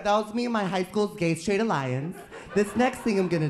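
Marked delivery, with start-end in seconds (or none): none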